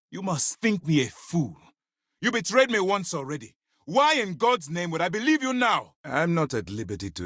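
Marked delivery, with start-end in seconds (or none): 0.1-5.9 high, indignant accusation; angry distrust. Acted. Commander to someone who betrayed him, angrily distrustful. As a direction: throw the betrayal back at them, pitch climbing.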